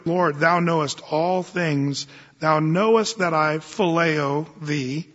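slow reverent. Retold or imitated. Peter's reply read.

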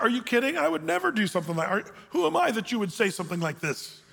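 high, quick protest of disbelief; mock indignation. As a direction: act out an offended, incredulous denial. Acts out Hazael's indignant protest.

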